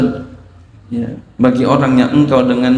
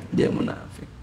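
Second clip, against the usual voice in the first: whispered echo; 13 dB quieter than usual. Acted. Preacher warning the congregation, dropping to a whisper. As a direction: fade the repeat into a near-silent whisper.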